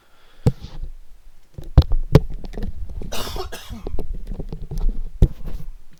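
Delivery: long harsh coughing fit. Real, not acted. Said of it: "Sorry, I, I'm not used to talking for this long".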